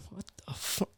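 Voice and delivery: hushed whisper of disbelief. Retold or imitated. Friend reacting after the set, whispered. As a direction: breathe it out under your breath. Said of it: "he was like".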